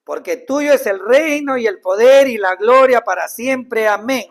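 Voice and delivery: proclaimed loud and slow. Shown as a demonstration. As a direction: declare it like a grand finale, loud and slow. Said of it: "Cae perfecto para una conclusión". (proclamado fuerte y lento, como conclusión solemne)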